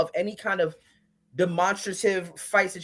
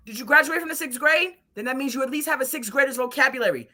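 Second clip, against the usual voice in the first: scolding the couple; 6 semitones higher than usual. Retold or imitated. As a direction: lecture them like kids.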